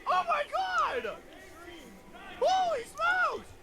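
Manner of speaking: very high, slow hype screams; excited screaming. Real, not acted. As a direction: scream at the screen in delight. Hype over aura on screen.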